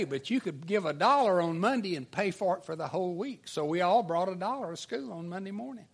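ordinary talk, nothing marked

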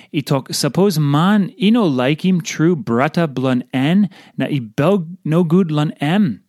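ordinary talk, nothing marked

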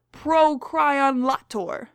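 slow, drawn-out Procryon lator; slow careful reading. Shown as a demonstration. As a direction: sound out Procryon lator slowly. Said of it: "I don't even take time".

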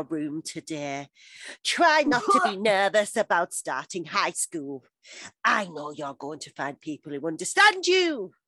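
slow, hammed-up love-letter reading; Dawson monster voice. Podcaster reads Dawson's love letter to Gretchen, monster voice. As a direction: overdo the lovesick boy, word by word. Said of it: "entire letter in Dawson monster voice".

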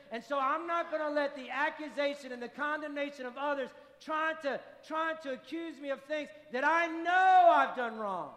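building to a loud preached cry; preaching climax. Real, not acted. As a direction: push it hard, full-voiced from the pulpit.

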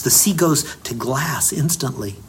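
ordinary talk, nothing marked

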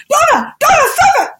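very high, loud shriek of reprimand; mock motherly yell; imitated scolding mother. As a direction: mimic a mother shouting at her child. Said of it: "imitating my mother".

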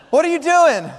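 high, curious little character voice; Piglet. Acted. Piglet questions his friend, high curious voice. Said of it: "So Piglet said".

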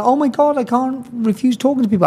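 gushing like a people-pleaser; a high mock gasp of guilt. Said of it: "I'm not one of these people that".

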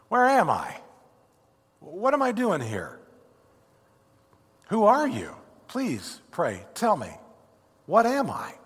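puzzled pleading, jumping up on each question; bewildered newcomer thief. Acted. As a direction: ask it like a soul lost in a new world. Thief bewildered on arriving in paradise.

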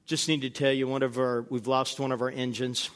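steady captain, keep it calm; a hint of a calm pilot on the intercom. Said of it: "He says".

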